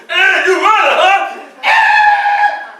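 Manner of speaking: high, loud jeering shout; mock taunt. Pretending to taunt the old runner, high loud shout. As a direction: jeer at the old man, then yell. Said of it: "I didn't go".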